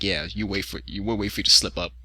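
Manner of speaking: low, quiet mock dockside threat; a hint of a Waterfront heavy; menacing mobster. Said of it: "guys from On the Waterfront".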